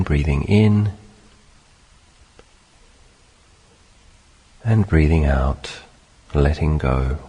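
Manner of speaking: measured meditation guidance in short phrases; unhurried meditation teacher, spacious phrasing; guided meditation. Acted. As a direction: guide them gently through it, long pauses between phrases.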